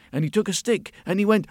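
usual speaking voice

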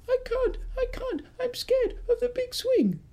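put-on high, small frightened girl's voice; scared little girl. Little girl afraid of the big swing, high frightened voice. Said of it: "she was saying".

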